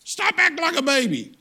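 sharp, very high, loud rebuke; the Lord rebuking a self-pitying man; the Lord's command. Retold or imitated. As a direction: snap him out of his self-pity. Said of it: "I felt like the Lord said".

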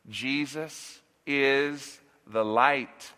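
drawn out, each word weighed and deliberate; slow solemn declaration. Acted. Preacher slowing down so the church hears Jesus is the light. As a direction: let it land slowly for the congregation. Preacher driving home the message.

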